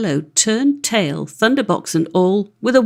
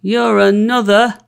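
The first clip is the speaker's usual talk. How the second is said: sturdy retort; 4 dB louder than usual. Acted. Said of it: "quoth Tom very sturdily".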